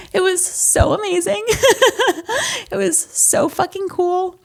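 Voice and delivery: high, laughing through the words; can't stop laughing at the memory; laughing with joy. Real, not acted. Recalling her daughter's birth, laughing through the words.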